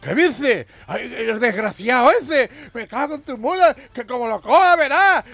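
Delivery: mock furious husband. Husband rages at the judge's deal, loud mock fury. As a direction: bluster like a jailed husband swearing revenge.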